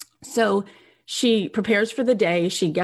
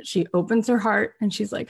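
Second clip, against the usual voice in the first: ordinary talk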